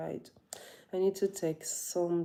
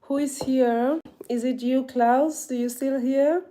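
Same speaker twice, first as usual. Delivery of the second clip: loud, high-pitched questions; calling out; 8 semitones higher, 9 dB louder than usual. Real, not acted. Vlogger asking whether Klaus is still here, calling out. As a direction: call out to check who's still around. Calling out to see who remains.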